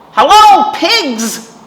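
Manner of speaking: high, loud cheery duck hello; Duck's greeting. A narrator's reading. Storyteller voicing Duck greeting Cow, high and loud. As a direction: call out brightly as Duck.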